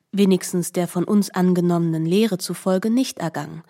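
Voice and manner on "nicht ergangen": ordinary talk, nothing marked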